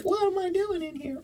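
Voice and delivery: high squeaky dog voice; voicing the dog. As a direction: speak for the dog in a tiny high voice. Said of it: "You like".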